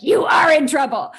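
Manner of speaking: the voice in her head she never lets out; inner voice. Retold or imitated. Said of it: "Inside, I'm like".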